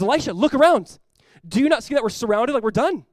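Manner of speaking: loud, high-pitched panicked cry; panicked freak-out. Acted. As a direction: call out in alarm as the terrified servant. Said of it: "begins to panic and freak out".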